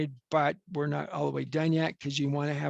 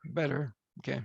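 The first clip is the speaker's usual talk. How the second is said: normal talking voice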